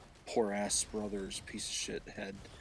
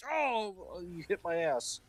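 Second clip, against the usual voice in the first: high comic whine of hurt; mock pained yelp; 10 semitones higher, slower than usual. Cain struck by God, mock hurt. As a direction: yelp in hammed-up pain.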